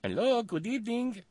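high, lilting European accent imitation of her greeting; mock European accent. Host mimicking the first lady's European-accented greeting. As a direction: parrot the clip's greeting in a breathy European lilt. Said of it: "sounded like a Swedish milkmaid".

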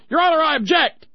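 high, loud "I object!"; objecting lawyer. Retold or imitated. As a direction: jump up and call out your objection to the judge. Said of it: "the other lawyer jumps up and says".

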